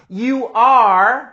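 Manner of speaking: "you are" said as two clear words; careful "you are"; full form. Shown as a demonstration. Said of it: "quando eles forem falar".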